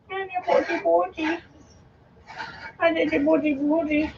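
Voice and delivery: put-on high hushed silly baby voice; high soft baby talk. Owner cooing silly nonsense to her dog, soft baby talk.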